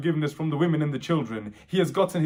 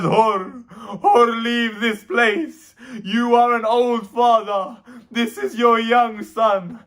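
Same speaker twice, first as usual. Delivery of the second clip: raised, drawn-out mourning recitation; wailing lament sermon; 5 semitones higher, 5 dB louder than usual. Acted. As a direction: preach in a full grieving wail.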